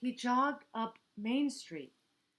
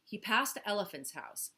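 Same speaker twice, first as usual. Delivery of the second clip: usual speaking voice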